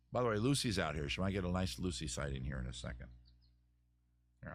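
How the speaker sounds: ordinary delivery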